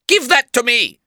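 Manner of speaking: high-pitched villain's command; urgent demand. A narrator's reading. Villain demands briefcase back.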